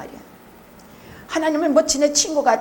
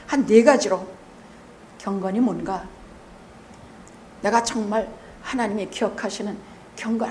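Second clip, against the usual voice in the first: normal talking voice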